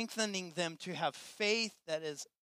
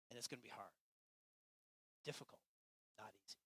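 much softer, fading sincere close; hushed hope; 3 semitones lower, 9 dB quieter than usual. Real, not acted. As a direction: speak softly of the calm shore. Quiet hopeful close of sermon.

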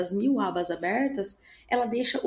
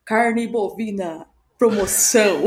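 supermarket announcement; 5 dB louder, slower than usual. Retold or imitated. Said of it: "ao fundo, assim, mercado".

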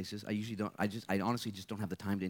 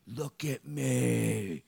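slow firm plea; 4 dB louder, much slower than usual. Acted. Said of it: "And Jesus said".

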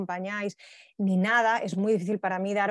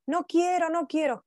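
put-on high, whining child's "no quiero"; imitating a cranky child who won't change; whiny toddler; 9 semitones higher, slower than usual. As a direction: play the tired little girl refusing, pitched way up. Toddler refusing pajamas.

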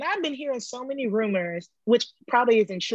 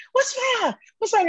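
high, urgent worried asking; alarmed; 14 semitones higher, 3 dB louder than usual. Retold or imitated. Lifter asking her coach what went wrong, high and alarmed. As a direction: press him anxiously for the news.

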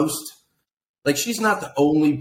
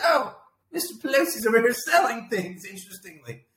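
high-pitched, sarcastic singsong wonder; put-on high voice, feigning astonishment; mock surprise; 9 semitones higher than usual. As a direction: play a fake-innocent onlooker making a discovery. Mimicking onlookers noticing the sale.

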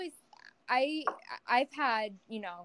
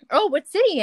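louder, higher retold question; keen interest; 8 semitones higher, 11 dB louder than usual. Driver asking his passenger where he's from, keenly interested. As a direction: perk up sharply with interest.

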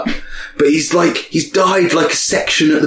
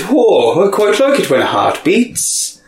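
put-on Irish accent; 6 semitones higher than usual. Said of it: "in Ireland they said".

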